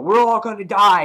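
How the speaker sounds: high, loud mock panic; freaking out. Retelling a comic character losing it mid-argument, mock panicked cry. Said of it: "he's just freaking out".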